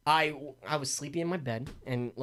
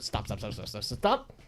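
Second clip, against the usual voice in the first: imitated noise; 8 semitones lower, 5 dB quieter than usual. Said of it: "I heard".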